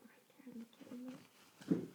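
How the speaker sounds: hushed storybook whisper. A narrator's reading. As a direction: whisper the hiding place. Narration whispered.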